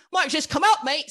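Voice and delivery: loud, high-pitched mock Cockney; bad Cockney accent. Shown as a demonstration. Guest asks host to try an English accent, bad Cockney. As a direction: burst into a hammy Cockney accent. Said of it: "My Cockney might just".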